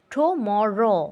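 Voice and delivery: Tomorrow in three drawn-out parts; teacher dividing tomorrow syllable by syllable; split into syllables. Shown as a demonstration. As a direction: say tomorrow as টু, ম, রো with each syllable held. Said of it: "দেখছো ভাগ করে দিছি সেভাবে দিছে".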